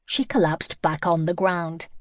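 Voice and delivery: usual speaking voice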